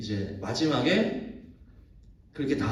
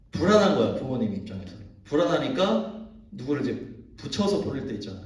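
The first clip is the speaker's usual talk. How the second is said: ordinary talk, nothing marked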